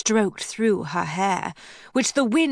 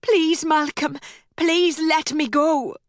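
higher, pleading cry; desperate plea; 7 semitones higher than usual. Acted. Rowena pleading with Malcolm, pitch raised in fear. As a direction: beg him to let go, voice climbing.

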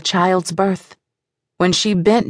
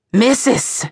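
higher, sharp call for attention; urgent call; 5 semitones higher than usual. Acted. Midwife calling to the mother in labour, urgent. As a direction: call out to rouse her.

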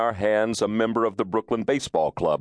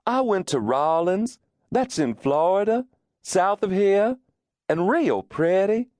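higher Southern belle voice, every phrase rising into a question; Georgia Belle drawl; 10 semitones higher than usual. Retold or imitated. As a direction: imitate a Georgia Belle, ending each clause with a lilting upward question. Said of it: "a Georgia Belle insert four question marks into a declarative paragraph".

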